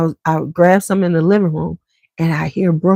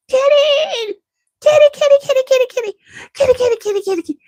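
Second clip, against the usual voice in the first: put-on very high squeaky toddler calling; toddler calling kitty; faster than usual. Toddler Brooke chasing the cat, high squeaky calling. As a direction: chase the cat with a high little-kid chant. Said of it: "she terrorized poor Juicy".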